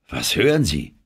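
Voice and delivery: plain reading voice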